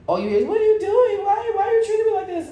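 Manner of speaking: high, whiny mock of a clingy friend. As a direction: mimic her pleading, high-pitched. Mocking the fake friend's whine.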